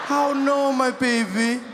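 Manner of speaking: hammed-up maternal grief; lamenting mom. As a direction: lament over your poor child. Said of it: "I felt like a mom".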